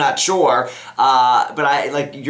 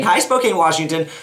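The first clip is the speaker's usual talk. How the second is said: high-pitched, sing-out greeting; cheery call-out; 5 semitones higher than usual. Real, not acted. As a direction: call out a greeting to a whole town. Greets letter writer's town aloud.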